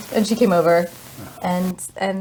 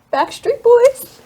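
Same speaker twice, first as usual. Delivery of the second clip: drawn-out answer; 5 dB louder, much slower than usual. Retold or imitated. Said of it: "I was like".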